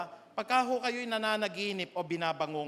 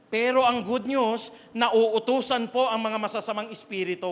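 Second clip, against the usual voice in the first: emphatic proclamation; 3 semitones higher, 7 dB louder than usual. Real, not acted. Preacher proclaiming good news.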